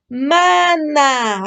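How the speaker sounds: "Mana" as long ma, long na; slow phonics Mana; sounding out syllables. Shown as a demonstration. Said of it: "A ver, di".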